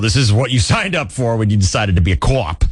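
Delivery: light mock Boston accent, cop as co-op; a touch of the Boston chief. Police chief reminding the officer, light mock Boston accent. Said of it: "And he's like".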